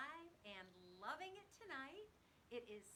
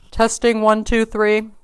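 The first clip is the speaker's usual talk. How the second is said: usual speaking voice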